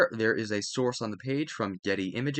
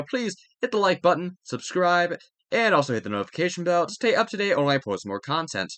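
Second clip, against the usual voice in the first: louder, energetic YouTuber sign-off; upbeat video outro; 3 semitones higher, 5 dB louder than usual. Acted. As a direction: pump up the viewers to like and subscribe.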